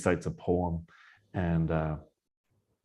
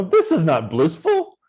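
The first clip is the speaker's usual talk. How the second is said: higher, louder, slow sneering mock; slowly mocking, loud and high; 7 semitones higher, 6 dB louder than usual. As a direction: sneer at it like it's no fun at all. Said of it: "they thought he was mocking".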